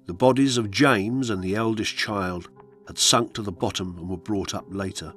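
ordinary delivery, nothing marked

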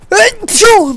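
loud sudden sneeze; real sneeze, high and loud; sneezing. Real, not acted. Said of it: "estornudo en directo".

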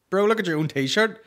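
ordinary delivery

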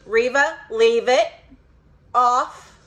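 loud sharp command to the dog; firm scold. Real, not acted. Owner loudly ordering Reva away from the cabinet. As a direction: call the dog off firmly.

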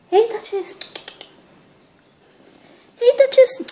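high and loud, calling the cat. Real, not acted. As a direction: sing out to a pet.

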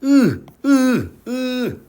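으 with lips unrounded; high, drawn-out 으 for learners; unrounded vowel, not 우. Shown as a demonstration. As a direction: model 으 clearly apart from a rounded 우. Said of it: "우가 아니고".

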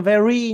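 "very" squeaked high and loud as the error; mock the bad version; exaggerated mistake; wrong "very", high. Shown as a demonstration. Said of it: "Đừng phát âm".

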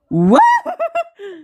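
very high squealing giggle; giddy giggle. Acted. Shy actor giggling about falling for the actress, high squeal. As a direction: let out a high lovestruck squeal.

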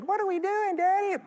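high mock kid voice asking daddy; child's voice. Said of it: "My kids ... going".

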